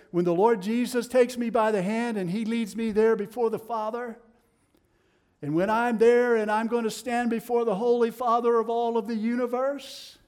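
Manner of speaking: loud, rising preacher's cry; full-force preaching. Acted. Preacher thundering to the congregation, loud and rising. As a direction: build it to a ringing peak.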